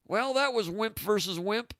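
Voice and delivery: a hint of a grumpy ref call; Heathcote voice. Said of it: "I didn't quite channel a, a good Heathcote voice".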